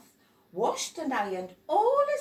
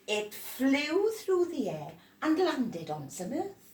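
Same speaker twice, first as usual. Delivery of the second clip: usual speaking voice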